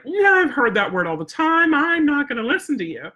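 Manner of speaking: high-pitched, dismissive dog voice; mock defiant dog. Spoiled dog answers its owner's come command, mock defiance. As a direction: brush off the command in a put-on high voice. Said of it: "they're already like".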